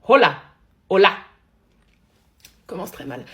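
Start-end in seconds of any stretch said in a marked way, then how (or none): 0.1-1.1 very loud, punched-out greetings; cris de jeu. Real, not acted. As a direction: shout each word at the game. Shouting greetings at a game. (crier très fort, mot par mot)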